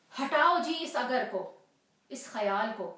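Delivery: loud, exasperated outburst at his own doubt; irritated dismissal. Acted. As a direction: push the nagging thought away forcefully.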